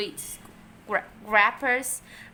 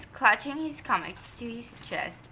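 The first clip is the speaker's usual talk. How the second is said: ordinary delivery, nothing marked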